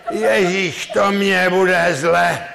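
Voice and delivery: high, loud, slow disgusted groan with a laugh; hammed-up drunk gagging on the drink.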